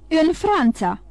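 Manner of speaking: the king cries out in shock; shouted. A narrator's reading. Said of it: "strigă el".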